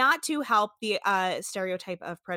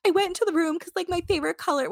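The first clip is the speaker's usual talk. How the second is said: higher mock quote; 9 semitones higher, 3 dB louder, faster than usual. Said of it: "she's got a Southern accent, but I'm not gonna try".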